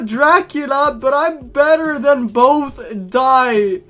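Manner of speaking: high, loud villain rant; unhinged villain. Acted. Friend turns on the players, ranting madly. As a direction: shout it like a crazed villain.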